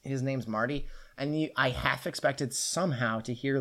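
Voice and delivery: ordinary talk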